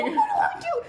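very high, frantic squeak; flustered woman panics aloud; panicked. Acted. Panicked woman voiced in scene.

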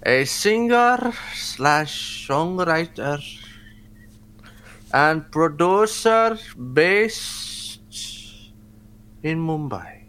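slow, lightly put-on Indian accent. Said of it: "do the Osho accent for him".

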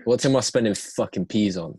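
ordinary talk, nothing marked

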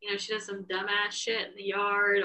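ordinary delivery

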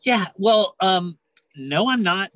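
ordinary delivery

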